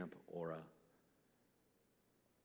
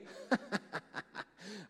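big laugh; 11 semitones higher than usual. Real, not acted. Laughing at Sarah's baby news.

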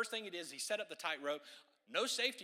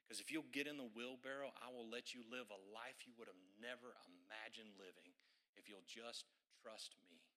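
gentle invitation; 6 semitones lower, 9 dB quieter than usual. Retold or imitated. Jesus voiced inviting trust.

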